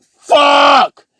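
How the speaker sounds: high, loud scream of pain; hammed-up agonized shout. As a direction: yell it out as the arm shatters. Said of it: "he's screaming".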